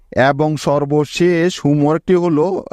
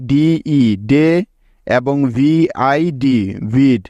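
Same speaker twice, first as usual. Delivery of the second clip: "ডিই ডে" and "VID ভিড" drawn out slowly, each letter sound apart; teacher sounding out syllables slowly; much slower than usual. Shown as a demonstration. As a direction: spell the syllables out slowly for the class. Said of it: "মোট দুটি syllable পেলাম".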